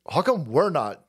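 ordinary talk

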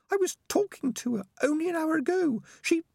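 very high-pitched, worried rat; Peaches the rat. A narrator's reading. Rat guessing at the danger ahead, high and worried. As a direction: wonder anxiously in a tiny high voice.

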